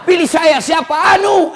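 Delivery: comic politician shouting; mock candidate. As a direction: ham up a nameless candidate. Mocking candidate repeated.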